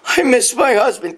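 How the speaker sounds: whiny imitation of the widow; mocking grieving widow. A man mocks the widow's fake grief, whiny.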